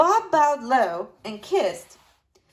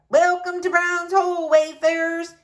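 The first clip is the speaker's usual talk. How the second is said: louder, higher welcome in a Scots brogue; thick Scots brogue; 9 semitones higher, 5 dB louder than usual. A narrator's reading. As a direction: greet the travellers in a hearty Scots brogue. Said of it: "in a thick Scots brogue".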